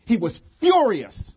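loud, high outburst on FURIOUS; dramatic emphasis. A narrator's reading. Preacher reveals the king's response, loud and dramatic. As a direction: punch out the word furious.

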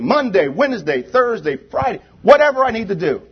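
high-pitched, driving sermon at full force; impassioned preaching. Real, not acted. Preacher urging the congregation to get mad and act, impassioned preaching. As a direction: urge them on with rising fervor.

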